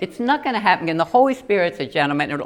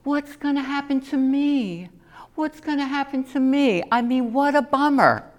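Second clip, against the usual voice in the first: worried self; 8 semitones higher than usual. Retold or imitated. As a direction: fret anxiously about yourself. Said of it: "I get to this place of".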